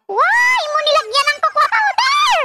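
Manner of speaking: high, loud outburst of laughing disbelief; real laughing cry of surprise; shocked laughing shout. Real, not acted. Shocked at cocoa on icing.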